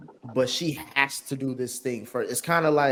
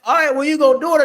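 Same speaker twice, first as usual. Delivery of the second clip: mock dare; 12 semitones higher, 8 dB louder, faster than usual. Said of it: "and I'm like".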